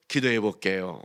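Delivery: low, soft, fake-humble voice; mock pious reply. Mocking pious congregant. (경건한 척하는 목소리)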